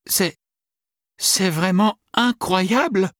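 high, slow, struggling to speak; stunned, stammering. A narrator's reading. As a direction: get the words out with effort. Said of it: "articula Sophie avec peine".

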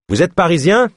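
high-pitched, delighted-sounding exclamation; exclaimed. A narrator's reading. Boatman spots fellow Parisians, exclaiming. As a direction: exclaim the question, pitch leaping up. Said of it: "s'exclama le batelier".

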